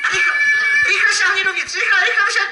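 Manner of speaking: high, fast urging; urgent hurry. Real, not acted. Magician urges the little assistant to grab quickly, high excited hurry. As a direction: hurry her up, voice jumping high.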